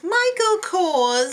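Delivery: high, loud, drawn-out name announcement; mock fanfare. Introducing the runway judge, mock grand fanfare.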